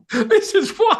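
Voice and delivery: very high, giggly amazement; squeaky laughing astonishment; laughing disbelief. Retold or imitated. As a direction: retell being blown away, words riding on a laugh.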